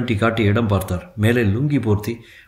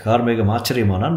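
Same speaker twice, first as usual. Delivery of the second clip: ordinary delivery, nothing marked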